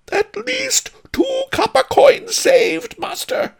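very high, loud, slow cry. A narrator's reading. Cook sharpens knives for free, exultant high cry. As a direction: shout the good news to your master, high-pitched. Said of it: "the cook cried".

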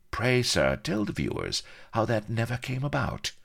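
high-pitched mock-period interviewer, needling the guest; snide chat-show interviewer. Chat-show host nudging Captain Bilbo about his defeat, snide.

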